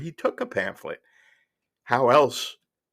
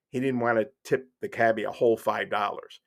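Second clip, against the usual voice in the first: usual speaking voice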